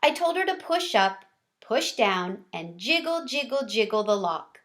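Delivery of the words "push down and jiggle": plain reading voice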